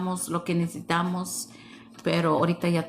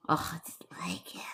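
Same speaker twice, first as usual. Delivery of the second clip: whisper; 3 semitones lower, 11 dB quieter, faster than usual. Real, not acted. Musing to chat about avocados.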